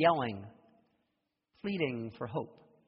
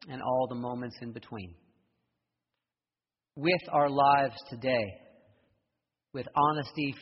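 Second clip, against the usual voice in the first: prayer; 3 dB louder than usual. Acted. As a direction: lead the congregation in prayer. Leads closing prayer.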